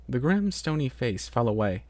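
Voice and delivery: plain reading voice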